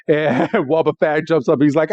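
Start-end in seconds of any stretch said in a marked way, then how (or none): none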